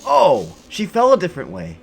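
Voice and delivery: high, loud startled exclamation; real surprise. Real, not acted. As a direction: burst out, caught off guard by what you just saw. Startled by changed fall scene.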